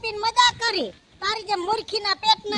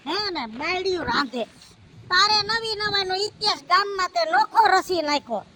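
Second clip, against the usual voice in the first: ordinary talk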